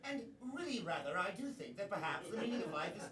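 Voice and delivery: put-on fast, prim British accent; polite British gentleman, British accent.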